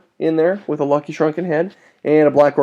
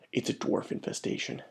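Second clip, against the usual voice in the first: whispered, a hammed-up hushed dread; mock horror whisper; 15 dB quieter than usual. Hamming up dread to viewers, whispering. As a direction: breathe it like a horror movie reveal.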